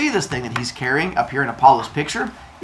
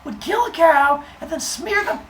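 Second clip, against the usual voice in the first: lightly voiced high, indignant Apollo; a hint of a scandalized god; outraged Apollo. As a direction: play Apollo as mock-shocked, voice squeaking up. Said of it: "He was like".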